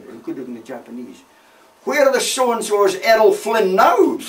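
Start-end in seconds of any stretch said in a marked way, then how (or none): 1.8-4.2 drawn-out, high, loud holler; slow bellow across the foxholes; retold shout. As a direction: shout it out for everyone to hear. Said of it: "he shouted out for everyone to hear".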